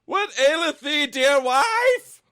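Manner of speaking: very high, slow, loud mock old-fashioned husband; hammed-up husband. Reading Rapunzel aloud, the husband asks his wife, hammed-up falsetto. Husband's line voiced by co-reader.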